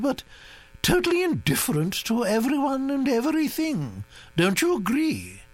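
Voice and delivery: high, commanding grand-lady voice; a bossy old aristocrat laying down plans; imperious old Duchess. A narrator's reading.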